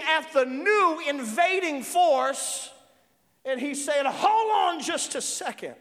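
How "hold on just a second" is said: higher-pitched challenge from a spirit; spirit confronting an intruder. Acted. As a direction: halt the intruder, acting the spirit. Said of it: "this is what the Spirit's doing".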